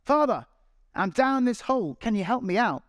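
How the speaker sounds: high, loud plea to the priest; shouting for help from a pit. Retold or imitated. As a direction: call up out of the hole. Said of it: "the guy shouts up".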